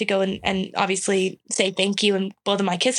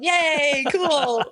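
higher, hollow cheering; mock cheer; 6 semitones higher than usual. Everyone cheering an Olympian's one-time moment, hollow and higher. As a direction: cheer without meaning it. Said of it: "everyone's like".

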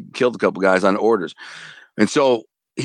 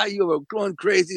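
laughing; 7 semitones higher than usual. Retold or imitated. Laughing while recalling.

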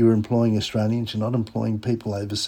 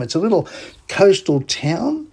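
ordinary delivery

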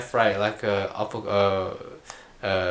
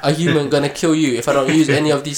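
raised, higher-pitched mock protest; indignant retort; 6 semitones higher, 7 dB louder than usual. Fan voicing a human protesting to a god, indignant. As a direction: argue back loudly in disbelief.

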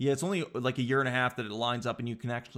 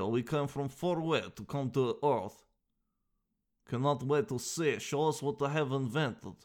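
mock Russian accent; visiting alien with a thick Russian accent. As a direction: greet the humans in a put-on Russian accent. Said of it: "you sound like Russia".

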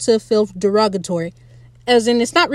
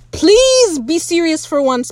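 high, drawn-out "please"; exasperated plea; 6 semitones higher, 4 dB louder, slower than usual. Real, not acted. Fan pleading with a hopeless character, exasperated. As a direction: beg them slowly, pitch soaring on please.

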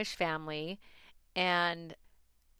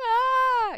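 high, loud crying cry; crying wail; 11 dB louder than usual. Real, not acted. Overwhelmed by worry about getting a dog, crying out. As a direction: let a real sob break out on a high wail. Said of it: "he sees me crying".

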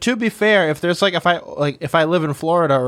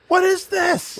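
high squeaky mock question; mock shocked voice; slower than usual. Voicing the Airbnb host seeing the spider photo, high squeak.

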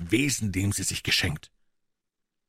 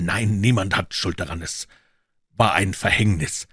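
ordinary delivery, nothing marked

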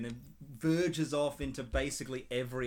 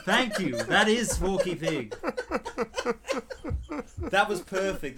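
laughing; 4 semitones higher, 6 dB louder than usual. Real, not acted. Praising the skit through laughs.

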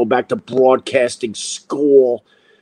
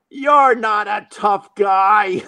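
slow, higher-pitched mock taunt; 5 semitones higher, much slower than usual. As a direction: drag it out to needle an opponent. Retold taunt to angry driver.

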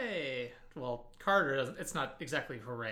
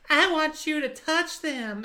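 much higher, louder, slow breathy coo; high flirty woman voice; 14 semitones higher, 10 dB louder, slower than usual.

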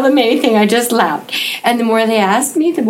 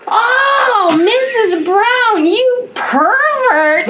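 high, slow, laughing mock yell of teenagers; mock kids yelling; 14 semitones higher, much slower than usual. Grade sevens tease their teacher Missus Brown, in a mock yell. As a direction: stretch it out like giggling kids teasing the teacher. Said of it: "they started yelling, and it sounded like this".

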